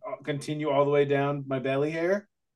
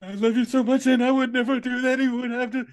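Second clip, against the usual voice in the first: high, pleading whine; begging an ex back; 10 semitones higher than usual. Pretend. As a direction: beg her not to leave. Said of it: "Man up".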